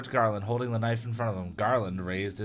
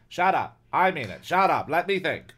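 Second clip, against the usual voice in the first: higher, loud cut-off; acted irritation, raised voice; exasperated gunman; 6 semitones higher, 6 dB louder than usual. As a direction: shut your partner up. Gunman snaps at nagging partner.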